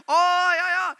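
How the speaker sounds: mock knowing yeah; smug teasing. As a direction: gloat like big siblings. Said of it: "we'd be like".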